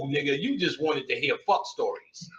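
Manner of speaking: ordinary talk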